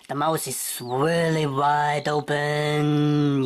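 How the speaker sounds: very slow, stretched-out vowels; drawn-out emphasis. Pretend. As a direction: stretch the words as wide as the mouth. Draws out the wide open mouth.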